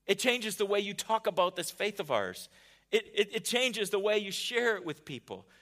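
loud, rising preacher's build; preaching at full force. Acted. Preacher thundering Jesus' answer to Martha, loud and urgent. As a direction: drive Jesus' words home to the crowd.

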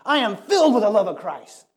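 ticked-off quote. Retold or imitated. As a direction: hurl the line like an angry movie character.

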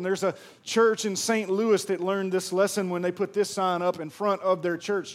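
ordinary talk

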